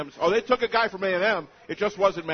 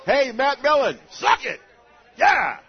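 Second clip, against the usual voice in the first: loud, higher taunting whoop; gloating cheer; 7 semitones higher, 8 dB louder, slower than usual. Real, not acted. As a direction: rub it in, crowing. Gloating at former Lions GM.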